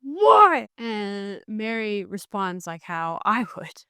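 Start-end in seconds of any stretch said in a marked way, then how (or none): 0.0-0.7 high, loud outraged "Why?"; a reader's loud outraged protest at the page; exasperated cry. Retold or imitated. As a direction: throw up your hands at the book. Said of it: "And I was so angry".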